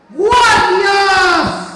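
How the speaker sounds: full-throated high yell; shouting for the guards. Shown as a demonstration. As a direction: bellow it across the room. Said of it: "yo voy a gritar".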